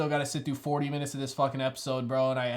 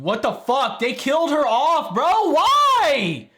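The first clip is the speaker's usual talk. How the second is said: much higher and louder, ranting; outraged yelling; 12 semitones higher, 9 dB louder than usual. Real, not acted. Viewer outraged the show killed her off, loud ranting. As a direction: rant in real disbelief at the screen.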